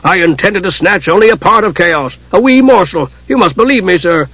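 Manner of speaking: high, urgent appeal; pleading dwarf. A narrator's reading. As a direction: plead innocence to a superior. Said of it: "The dwarf turned humbly".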